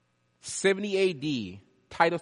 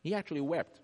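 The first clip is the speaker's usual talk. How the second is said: usual speaking voice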